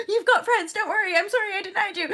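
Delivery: high, laughing comfort; laughing through tears. Acted. Consoling her inner child, laughing through tears. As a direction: soothe while half laughing.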